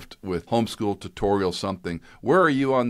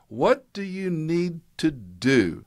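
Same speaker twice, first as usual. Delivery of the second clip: very slow, much higher drawn-out demand; stern dad; 10 semitones higher, much slower than usual. Retold or imitated. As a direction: stretch every word so he can't dodge.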